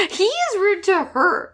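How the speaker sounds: high, drawn-out laughing indignation; amused outrage. Real, not acted. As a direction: protest it high-pitched through laughter. Laughing outrage at the character's rudeness.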